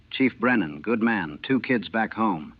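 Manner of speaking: ordinary delivery, nothing marked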